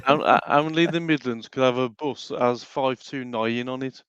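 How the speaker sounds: slow, put-on Brummie; Brummie accent. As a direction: play a Brummie proud of the local bus, slowly. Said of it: "I can do a Brummy accent".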